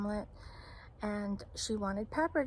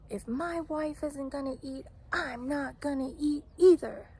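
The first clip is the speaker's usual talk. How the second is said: higher-pitched mock indignation, building louder; imitating an indignant husband; 4 semitones higher, 3 dB louder than usual. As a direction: act out his stubborn refusal, rising in force. Irate husband acted out.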